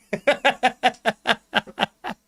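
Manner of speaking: bursts out laughing; a big real laugh; laughing hard at chat. Real, not acted.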